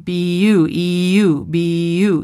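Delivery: B U, E U drawn out, the U glide stretched; diphthong in beautiful. Shown as a demonstration. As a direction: sound out the yoo glide slowly. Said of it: "and then the word beautiful".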